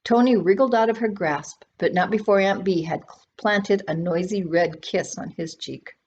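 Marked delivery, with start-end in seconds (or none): none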